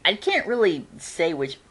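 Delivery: ordinary talk, nothing marked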